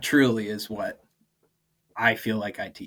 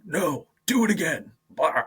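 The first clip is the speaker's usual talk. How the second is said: mock stern instructor; 6 semitones higher than usual. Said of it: "a grown man standing ... going".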